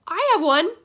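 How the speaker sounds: high, loud announcement; calling out. A narrator's reading. Mister Chang offers a solution, calling out. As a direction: call it out over a crowd. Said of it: "Mister Chang call out".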